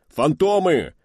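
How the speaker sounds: high, loud cry of alarm; alarmed yell. Acted. Crewman cries out as the Phantoms dive. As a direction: cry out the warning.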